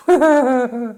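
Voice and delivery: high, loud burst of laughter. Real, not acted. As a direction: laugh loudly at your own dark joke. Joke that suicide's a laugh.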